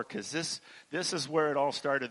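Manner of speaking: ordinary talk, nothing marked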